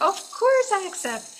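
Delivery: overjoyed reply; thrilled yes. Retold or imitated. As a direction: sing out the acceptance. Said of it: "And I'm like".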